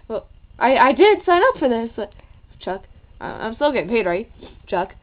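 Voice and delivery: ordinary talk, nothing marked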